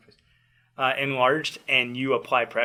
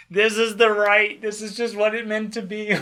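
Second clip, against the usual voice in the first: cocky triumph; 8 semitones higher, 4 dB louder, slower than usual. Retold or imitated. Triumphant quote of own past self.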